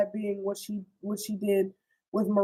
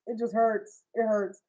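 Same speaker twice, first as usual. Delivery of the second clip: usual speaking voice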